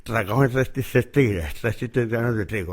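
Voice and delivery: "Tragaban tres tristes tigres" with a pen between the teeth, consonants blurred; pen in mouth. Shown as a demonstration. Teacher showing the class the tongue twister with a pen in the mouth. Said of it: "Te pones la pluma en la lengua".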